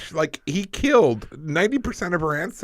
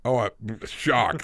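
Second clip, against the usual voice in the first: slow, drawn-out sarcastic surprise; mock shock; much slower than usual. Co-host hears the rat plan failed, sarcastically shocked. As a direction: feign shock, stretching it out.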